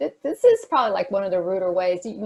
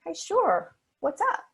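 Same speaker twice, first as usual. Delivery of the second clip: bright, higher, engaged sure what's up; phone away, answering a friend with a higher eager voice; attentive reply; 10 semitones higher, slower than usual. Retold or imitated. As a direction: sound like you really want to hear them. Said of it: "you put your phone in your pocket".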